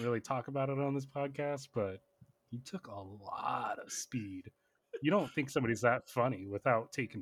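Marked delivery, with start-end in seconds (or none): 2.5-4.5 "lot" drawn right out, slow; stress on LOT. Real, not acted. Podcaster jokes about a comedian, drawing out "lot". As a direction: stretch "lot" long for comic emphasis. Joking repeat, drawn out for emphasis.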